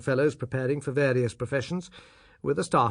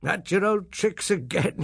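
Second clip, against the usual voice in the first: laughing; 6 semitones higher than usual. Acted. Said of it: "he laughed".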